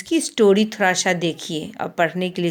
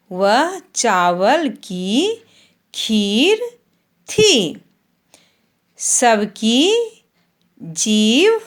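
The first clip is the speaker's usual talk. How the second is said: slow, sing-song primer reading, long vowels stretched; reading to children; 2 semitones higher, much slower than usual. Acted. Teacher reads the Hindi primer to young pupils, slow and sing-song. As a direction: read each word slowly for the class to repeat.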